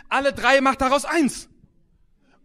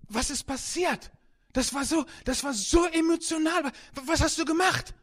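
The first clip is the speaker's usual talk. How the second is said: higher-pitched, astonished questioning; mock stunned amazement; 6 semitones higher than usual. Congregation moved by the old man, high-pitched amazement. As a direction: ask it as if overwhelmed and baffled.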